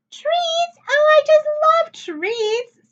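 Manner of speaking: very high, loud squeal of delight about treats; a little girl bouncing with joy, squealing; bouncy excitement. A narrator's reading. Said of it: "jumping up and down".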